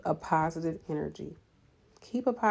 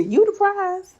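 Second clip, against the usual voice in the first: YOU THE PRIZE pitched way up and loud; emphatic hype; 13 semitones higher, 8 dB louder, slower than usual. Real, not acted. Coach hyping up fellow nurses, high loud insistence. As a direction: insist loudly, voice leaping high. Emphatic repeat after "look at me.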